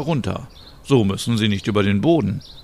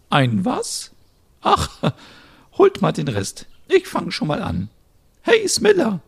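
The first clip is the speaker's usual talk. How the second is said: put-on very high, thin old-lady voice; old neighbour offering help, high and frail; elderly Merit; 11 semitones higher than usual. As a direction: play a sweet old neighbour.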